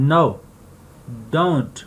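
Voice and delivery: ordinary talk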